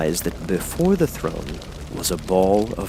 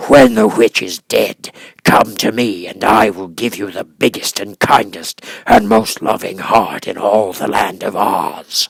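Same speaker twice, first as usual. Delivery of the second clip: beast voice; 7 dB louder than usual. Acted. Beast continues.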